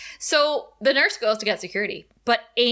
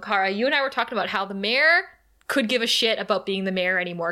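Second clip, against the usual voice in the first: ordinary talk, nothing marked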